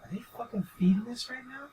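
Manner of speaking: incredulous whisper. Retold or imitated. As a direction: hiss it under your breath. Disbelief at crowd chant.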